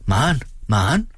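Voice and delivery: searching call, second name rising high. A narrator's reading. Mother calls for Maren out across the yard, calling out. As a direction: call out with a lifted pitch, not much volume. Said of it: "råbte hun ud over gården".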